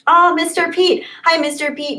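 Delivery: high, bright-eyed hello to a friend; light up and greet him; excited greeting. Real, not acted. Streamer greeting a chat friend, high and excited.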